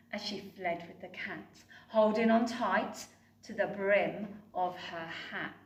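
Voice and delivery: ordinary delivery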